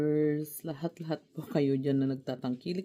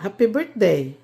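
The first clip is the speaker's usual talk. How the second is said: loud, clear happy birthday to a hard-of-hearing mother; loud birthday greeting; 3 semitones higher, 10 dB louder than usual. Real, not acted. Daughter greets her old mother loudly, loud and clear. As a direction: raise your voice so mom hears.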